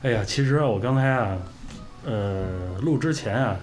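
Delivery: ordinary delivery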